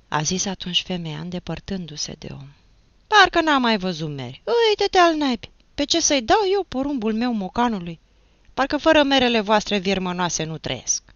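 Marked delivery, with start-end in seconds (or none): 3.1-11.0 loud, high scornful outburst; indignant yelling. A narrator's reading. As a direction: yell your contempt at the seller. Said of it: "Nu țipa, leică, de ce țipi așa". (țipăt indignat, disprețuitor)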